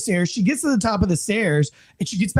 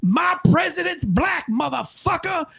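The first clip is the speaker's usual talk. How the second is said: defiant suspect; 10 semitones higher than usual. Retold or imitated. Suspect smashing a painting over officer's head, high defiant cry. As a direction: crow it defiantly in a put-on high voice.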